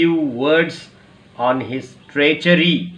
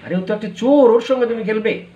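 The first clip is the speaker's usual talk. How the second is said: incredulous scolding; 8 semitones higher, 3 dB louder, faster than usual. Acted. Inspector scolds child.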